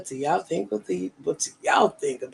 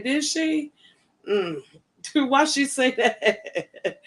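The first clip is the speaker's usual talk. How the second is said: high, slow, laughing disbelief; 8 semitones higher, much slower than usual. Real, not acted. Hearing a viewer's gossip, laughing in disbelief. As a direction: laugh out a stunned question.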